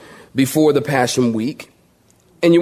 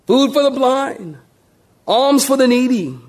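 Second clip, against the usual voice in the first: mock street cry; 9 semitones higher than usual. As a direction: call out to passers-by for alms. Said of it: "They're screaming to people".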